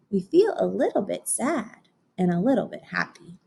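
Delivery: put-on gentle storybook narration for kids; picture book reading. A narrator's reading. Teacher reading to her class, storybook voice. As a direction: read it like story time on the rug.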